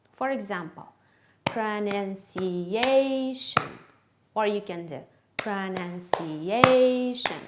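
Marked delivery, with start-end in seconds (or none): 1.4-3.6 "pronunciation" drawn out slowly with a long, loud "-A-"; stressed-syllable demo. Shown as a demonstration. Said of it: "The stressed syllable you tap". 5.4-7.4 "pronunciation" slow, its "-A-" long and much louder; stressed-syllable demo. Shown as a demonstration. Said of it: "The stressed syllable you tap".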